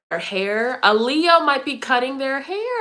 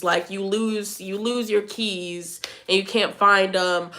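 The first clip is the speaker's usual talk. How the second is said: ordinary talk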